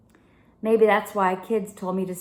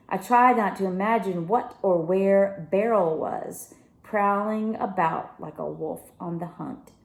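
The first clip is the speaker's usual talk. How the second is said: ordinary delivery, nothing marked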